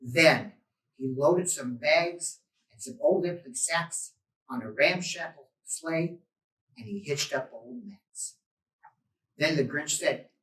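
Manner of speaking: ordinary delivery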